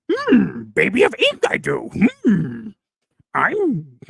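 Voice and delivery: croaky little alien sage with long hums; Yoda voice. Acted. Host reading a message on request, Yoda voice. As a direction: do an odd wise creature, humming between phrases. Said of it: "Read it in a Yoda voice".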